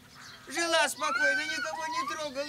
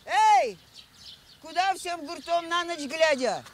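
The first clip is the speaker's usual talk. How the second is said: calling out; 8 semitones higher than usual. Acted. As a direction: shout after them to stop. Aunt hails the children leaving.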